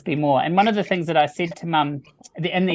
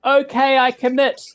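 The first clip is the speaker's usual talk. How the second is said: higher and louder, calling it out; excited declaration; 9 semitones higher, 6 dB louder than usual. Retold or imitated. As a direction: declare it to the sky. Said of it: "and I said".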